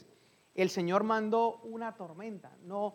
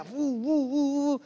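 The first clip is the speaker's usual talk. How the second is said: soplido imitado "bu, bu", más agudo; viento de tormenta; 5 semitones higher, 4 dB louder, much slower than usual. Retold or imitated. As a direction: imitar el rugido del viento. (predicador imita la tormenta con soplidos agudos)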